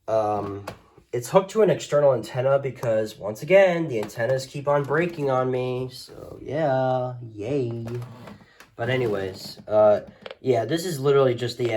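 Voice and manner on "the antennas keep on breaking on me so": ordinary delivery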